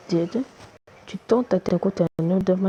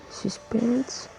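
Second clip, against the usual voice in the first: hushed suspense; 3 semitones higher, 6 dB quieter than usual. Real, not acted. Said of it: "est-ce que ça va marcher?".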